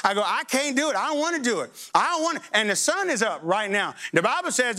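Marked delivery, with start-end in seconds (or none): 0.2-2.4 put-on high, whining like a child; childish whine of I don't wanna; whiny refusal. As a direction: plead out of it like a sulky kid.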